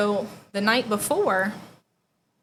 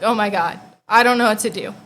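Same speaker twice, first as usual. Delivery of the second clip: loud retold panic; freaking out; 8 dB louder than usual. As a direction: blurt it out louder, panicked. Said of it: "I start freaking out".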